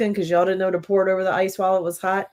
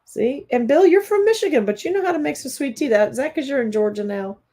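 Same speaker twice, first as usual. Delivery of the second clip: excited call-out; 7 semitones higher, 3 dB louder than usual. Real, not acted. Calling out to Bill.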